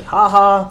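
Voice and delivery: loud, high burst of laughter; gleeful laugh. Real, not acted. Picturing a funny card play.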